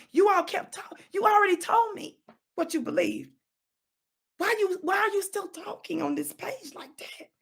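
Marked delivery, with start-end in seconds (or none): 4.4-7.3 breathy whisper of disbelief; whispered reproach to commenters; whispered question. Real, not acted.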